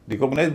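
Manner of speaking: Recognise as ri-KOG-nize; wrong second-syllable stress. Shown as a demonstration. Teacher explaining the error, recognise stressed on "kog". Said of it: "दूसरे पर stress आ जाता है".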